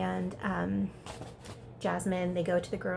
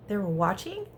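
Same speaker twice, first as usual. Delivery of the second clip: gasp, then a questioning realization; surprised question after a gasp; mock surprise. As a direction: imitate boys just catching on.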